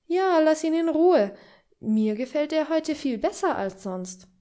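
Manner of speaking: a lady of the house playfully taking his side; lively hostess. A narrator's reading. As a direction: jump in brightly to defend him. Käthe defends Henning.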